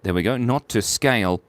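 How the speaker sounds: ordinary delivery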